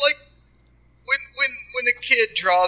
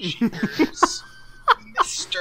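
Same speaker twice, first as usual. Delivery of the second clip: put-on higher, innocent kid voice; bright gee-whiz kid, pitched up; wholesome 1950s kid; much slower than usual. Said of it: "I was going for innocent".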